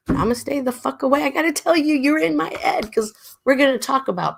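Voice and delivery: high, lively and animated; excited high voice. Real, not acted. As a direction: talk it up excitedly.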